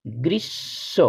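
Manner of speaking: quiet corrected গ্রীষ্ম; correct pronunciation. Shown as a demonstration. Teacher giving pupils the right form, softly. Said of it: "সঠিক উচ্চারণ".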